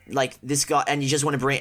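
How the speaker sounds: ordinary delivery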